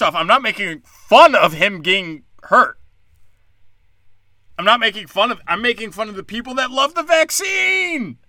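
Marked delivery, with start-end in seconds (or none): 4.6-8.2 higher, emphatic shout; ranting shout. Real, not acted. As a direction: yell the point home.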